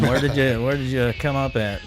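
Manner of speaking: ordinary talk, nothing marked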